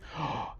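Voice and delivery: sharp sudden gasp of delight; excited gasp. Real, not acted. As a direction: gasp as the idea hits.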